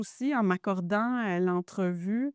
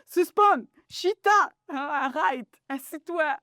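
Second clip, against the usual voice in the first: high commands in a Haitian accent; Haitian accent, authoritative; 7 semitones higher, 4 dB louder than usual. Shown as a demonstration. Mother showing how she commands her children, authoritative Haitian accent. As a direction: order the kids about in a Haitian mother's accent. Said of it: "mon accent haïtien".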